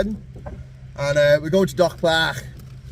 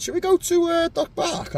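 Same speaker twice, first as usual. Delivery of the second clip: higher mock voice of his mate; imitating John Boy; 13 semitones higher than usual. Retelling his mate's suggestion in a higher mock voice. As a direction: put on a high, silly voice for his mate's question. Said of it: "John Boy just said".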